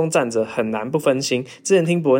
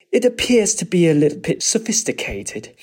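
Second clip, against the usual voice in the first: mock posh English; 5 semitones higher than usual. Mocking sophisticated English.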